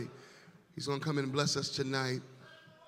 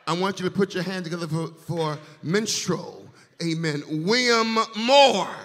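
loud, rising hype calling out the singer's name; hype host; 7 semitones higher, 11 dB louder than usual. Real, not acted. Host to church crowd, loud rising hype. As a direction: whip up the room, voice climbing to a shout.

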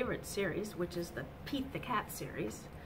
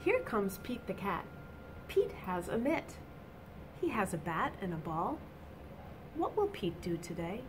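bright, lively storybook reading; reading to children; 4 semitones higher, 4 dB louder than usual. A narrator's reading. Teacher reading a picture book to children, animated. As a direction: read it out to the little ones with energy.

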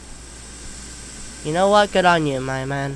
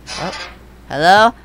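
usual speaking voice

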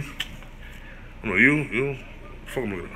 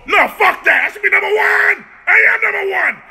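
angry rant; 8 semitones higher than usual. Real, not acted. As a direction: tell her off at full volume. Ranting about being first.

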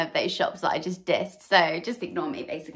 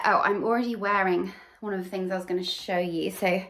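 ordinary talk

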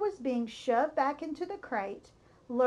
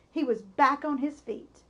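plain reading voice

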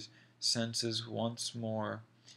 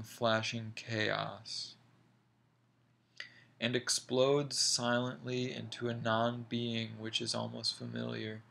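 ordinary delivery

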